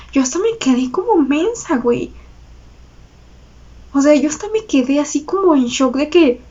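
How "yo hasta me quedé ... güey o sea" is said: normal talking voice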